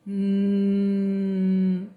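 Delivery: the N sound as a hummed nnn; letter sound. Shown as a demonstration. Teacher models the letter N sound to children, hummed. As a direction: hum the N sound through the nose. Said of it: "é o sonzinho dela".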